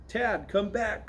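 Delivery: high, drawn-out call. A narrator's reading. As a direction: call a brother back, lightly. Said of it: "called Willie".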